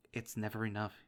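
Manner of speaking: ordinary delivery, nothing marked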